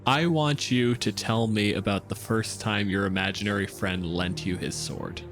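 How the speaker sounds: ordinary talk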